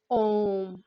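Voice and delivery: ओम held very long and slow, x0.3; drawn-out Om. Shown as a demonstration. Teacher showing students a long pluta vowel, ओम stretched slowly. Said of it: "बहुत ज्यादा समय लगा ना इसमें".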